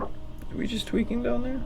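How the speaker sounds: high, slow, soft pet voice. Real, not acted. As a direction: coo at the cat. Talking to the cat.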